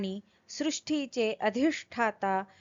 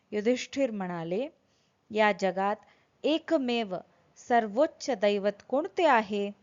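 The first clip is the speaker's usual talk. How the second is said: ordinary talk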